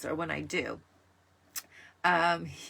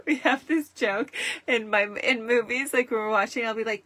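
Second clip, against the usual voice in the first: ordinary delivery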